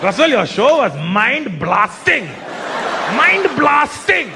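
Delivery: slow, hammed-up Indian-accented rave, climbing high; emphatic Indian accent. Indian fan raving about the show, drawn-out emphatic Indian accent. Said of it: "emphatic with their compliments".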